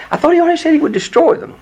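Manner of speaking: hammed-up baffled objection; mock puzzlement. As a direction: play the confused reader for a laugh. Playing the puzzled reader.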